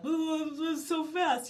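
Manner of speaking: high, slow, overwhelmed whine; mock overwhelmed brother. Brother complaining the audio is too fast, in a high slow whine. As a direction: play the flustered brother who can't keep up.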